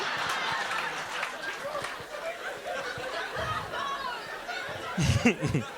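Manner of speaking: long quiet high laugh; high breathy laugh. Real, not acted. Laughing at his own punchline, high and long.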